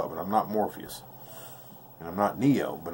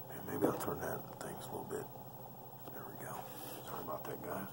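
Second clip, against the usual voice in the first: whisper; 2 semitones lower, 19 dB quieter than usual. Real, not acted. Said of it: "unvoiced whisper".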